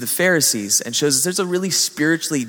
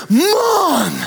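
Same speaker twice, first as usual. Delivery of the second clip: put-on high, loud kid's yell across the store; child calling out; 13 semitones higher, 5 dB louder than usual. Child spotting the volunteer in a packed store, high loud shout. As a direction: shout it like a kid spotting someone. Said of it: "points at this volunteer and goes".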